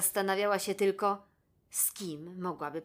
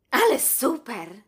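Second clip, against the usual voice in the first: slow, louder cry of joy; excited exclamation; 5 semitones higher, 9 dB louder than usual. A narrator's reading. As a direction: burst out with delight over the goggles. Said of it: "wykrzyknęła". (radosny, głośny okrzyk, powoli)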